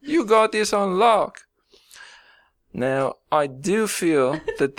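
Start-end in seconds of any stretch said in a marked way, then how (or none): 0.0-1.4 put-on foreign accent pitched much higher, like a salesman pep talk; hammed-up Borat-style accent giving life advice; comic high foreign accent, motivational rant; mock accent, Borat-style. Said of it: "maybe the Borat, possibly".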